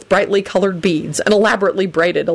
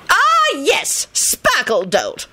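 slow, swooping high, suspicious recognition; drawn-out haughty doubt; 8 semitones higher, slower than usual. A narrator's reading. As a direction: draw the words out with lofty suspicion. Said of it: "John muttered suspiciously".

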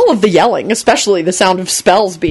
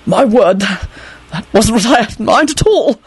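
slow, higher, faltering dismay; weak dismay; 3 semitones higher, slower than usual. Acted. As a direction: falter through it, appalled. Arthur dismayed at his spell's result.

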